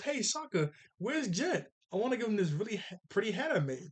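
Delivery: high-pitched, eager girl impression; Katara. Katara running over to ask Sokka about Jet, high eager voice. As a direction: pitch it up, quick and keen.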